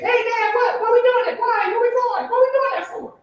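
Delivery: put-on high-pitched pestering child; squeaky mock kid voice; little curious kid. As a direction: fire off questions like an eager small boy. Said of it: "like, a little curious kid".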